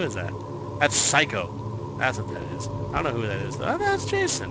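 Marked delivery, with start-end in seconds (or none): 3.7-4.5 much higher comic voice; joking falsetto aside; high silly voice. Real, not acted. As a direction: squeak it out goofily. Goofy realisation voice.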